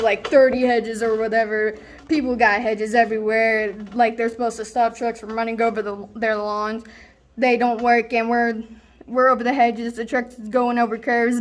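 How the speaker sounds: usual speaking voice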